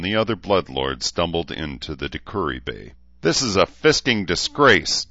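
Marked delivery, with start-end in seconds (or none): 3.2-5.1 raised, higher voice in mild outrage; angry. A narrator's reading. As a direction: fume about it, louder but controlled. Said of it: "Dean said angrily".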